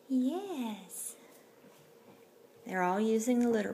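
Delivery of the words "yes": high cooing yes to a kitten; high, light pet-talk yes. Real, not acted.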